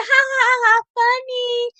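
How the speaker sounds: loud, high mock laughter; sarcastic fake laugh. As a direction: force out a big humorless ha-ha. Sarcastic reply to his teasing.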